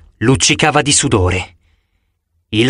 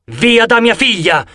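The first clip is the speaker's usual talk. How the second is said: higher, slow, fierce shout; 8 semitones higher than usual. A narrator's reading. Dr. Chase shouting at the monsters from his plane. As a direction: roar it over the engine. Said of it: "gridò il dottor Chase".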